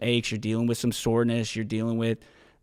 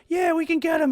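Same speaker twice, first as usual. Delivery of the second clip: high, louder mock call back; shouted reply; 5 dB louder than usual. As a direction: imitate someone yelling back a quick yes. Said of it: "like, yell at some people".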